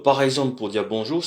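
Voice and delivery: usual speaking voice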